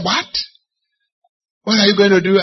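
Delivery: high, sharp disbelieving cry; can't believe what he just heard; shocked disbelief. Retold or imitated. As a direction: brother hearing he won't travel. Brother shocked at the news.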